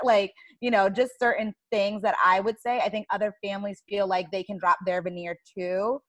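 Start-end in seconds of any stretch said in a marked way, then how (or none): none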